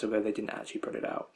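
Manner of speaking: fast, quiet mumbling under the breath. Real, not acted. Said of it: "I'm mumbling".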